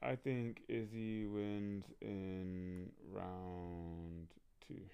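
very slow, words stretched out; slow, hesitant prediction with a pause before the last word; drawn-out deliberation. Real, not acted. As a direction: think it through aloud, stretching each word. Drawing out a fight prediction.

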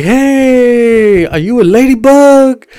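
high, drawn-out mock flirting; flirtatious come-on.

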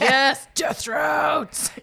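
hammed-up excited agreement; mock enthusiasm. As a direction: stretch it out like a gleeful cry.

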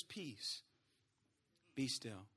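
very soft, low and unhurried; calm command. Acted. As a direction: hush the storm gently. Jesus calmly stills the storm.

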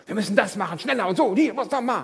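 high, loud, rushed mock bustle; hectic driven people. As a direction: play the frantic busybody, half-laughing. (hektisch und gehetzt, halb lachend)

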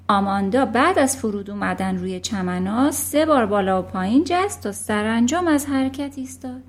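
usual speaking voice

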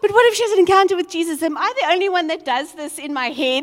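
thrilled run-on; excited rush. Retold or imitated. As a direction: race through it giddy. Excited self-talk retold.

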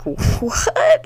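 very high, drawn-up incredulous question; acted shock. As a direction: exaggerate disbelief, pitch leaping up. Reaction to second punch.

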